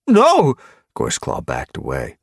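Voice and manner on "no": high, loud cry; frightened outburst; panicked denial. Acted. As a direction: deny it in alarm. Underling backs away in fear.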